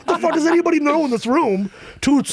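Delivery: high-pitched, rapid mock outburst; hammed-up comic fury, pitch way up; exasperated rant. Fed-up student ranting at classmates' opinions, mock-exasperated.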